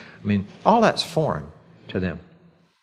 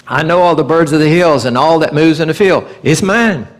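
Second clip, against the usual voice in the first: higher, louder declaration as God; God's proclamation; 4 semitones higher, 5 dB louder than usual. Retold or imitated. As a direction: proclaim it from on high. Quoting God in Psalm 50.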